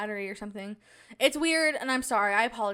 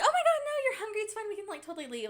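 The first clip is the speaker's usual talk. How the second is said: very high, over-accommodating reassurance; falsely agreeable; 11 semitones higher, faster than usual. Retold or imitated. Telling hungry companions they can leave, high fake agreeableness.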